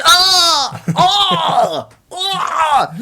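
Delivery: long, loud high-pitched mock screams; mock startled screams. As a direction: scream as if sprayed awake, hammed up. Acts sleeping woman sprayed with beer.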